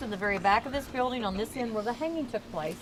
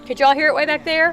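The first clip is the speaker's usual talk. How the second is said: raised, louder voice to the back; calling out; 4 semitones higher, 9 dB louder than usual. Real, not acted. Guide checking the back of the group can hear, calling out. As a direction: project to the people at the back.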